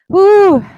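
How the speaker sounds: high, loud victory woo; excited whoop. Real, not acted. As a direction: celebrate with a whoop. Celebrating the finish.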